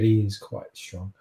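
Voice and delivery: ordinary delivery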